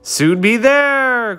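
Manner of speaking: high, slow, kindly call. Acted. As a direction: encourage the tired bee gently. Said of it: "called the fireflies kindly".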